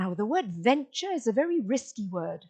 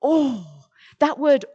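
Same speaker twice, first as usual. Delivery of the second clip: ordinary talk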